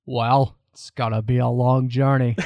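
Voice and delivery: lightly voiced gruff cowboy; John Wayne impression. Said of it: "That's my awful John Wayne impression".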